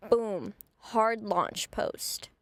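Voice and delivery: usual speaking voice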